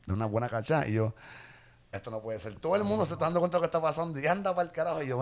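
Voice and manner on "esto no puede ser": low hushed mutter to himself; barely voiced, under the breath; muttered aside. Grandson muttering to himself in disbelief, hushed. Grandson retells his private reaction.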